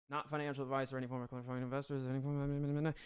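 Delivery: quick throwaway legal mumble; fast mumbled disclaimer. Real, not acted. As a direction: rattle off the disclaimer under your breath. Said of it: "we're gonna call it".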